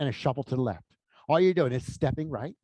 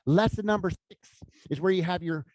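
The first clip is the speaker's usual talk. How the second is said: ordinary delivery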